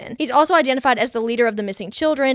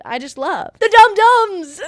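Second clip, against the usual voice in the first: gushing; 9 semitones higher, 7 dB louder than usual. Real, not acted. Gushing over the music.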